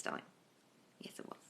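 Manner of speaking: breathy whispered confirmation. Real, not acted. Coach whispers a yes to a viewer's question. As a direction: drop to a secret whisper.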